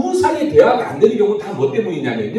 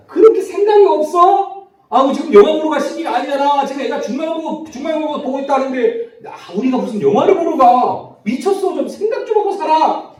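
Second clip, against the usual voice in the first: much higher, loud incredulous scolding; exasperated scolding; 9 semitones higher, 4 dB louder than usual. Retold or imitated. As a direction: scold a spouse who seems thoughtless. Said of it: "라는 말을 해요". (어이없다는 듯 높고 크게 나무라기)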